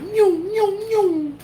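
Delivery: high, loud playful cat meows; mock meows back. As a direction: meow back at the cats like a cat. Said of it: "and I'm like".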